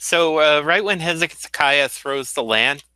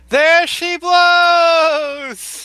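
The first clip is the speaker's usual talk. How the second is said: high, loud, long-drawn lookout's cry; lookout's scream; 8 dB louder, much slower than usual. Acted. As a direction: bellow it from the masthead, stretching every word. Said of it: "you hear the lookout screaming".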